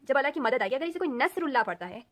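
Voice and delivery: ordinary talk, nothing marked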